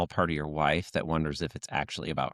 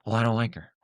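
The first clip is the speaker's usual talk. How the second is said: higher-pitched, picky sitcom complainer; George Costanza impression; 5 semitones higher, faster than usual. Quoting a sitcom man rejecting a woman, petty complaint. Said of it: "remember George Costanza on the old show".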